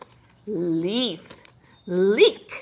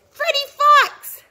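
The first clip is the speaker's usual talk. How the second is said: cheery intro; 11 semitones higher, 5 dB louder than usual. Acted. Introducing a puppet character.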